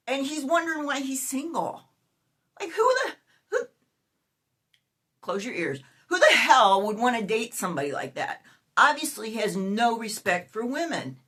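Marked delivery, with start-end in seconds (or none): 2.6-3.6 high, exasperated who the; high indignant outburst; outraged cry. Real, not acted. Outraged at the man. 6.1-8.4 indignant rant. Real, not acted. Indignant rant about him.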